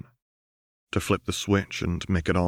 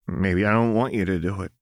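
shaky; faster than usual. A narrator's reading. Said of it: "Jinx said shakily".

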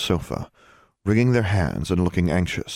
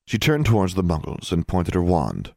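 ordinary delivery, nothing marked

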